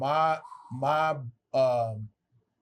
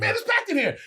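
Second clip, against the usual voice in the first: high mock voice; 13 semitones higher, 4 dB louder than usual. Retold club owner exchange.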